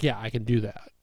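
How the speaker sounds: usual speaking voice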